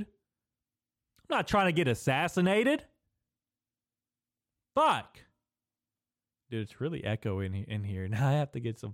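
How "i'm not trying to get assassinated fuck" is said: rising into a high yell; loud outburst. Real, not acted. Podcaster yelling alone in his studio, high and loud. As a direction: burst out high and loud in mock panic. Joking about being assassinated, outburst.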